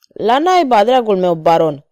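plain reading voice

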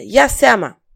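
loud, urgent warning; shouted warning. A narrator's reading. King to his courtier, shouting a warning. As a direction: shout to watch out. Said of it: "strigă regele".

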